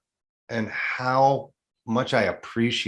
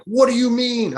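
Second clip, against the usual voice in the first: mock alarmed reaction; 12 semitones higher, 5 dB louder than usual. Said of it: "I wasn't like".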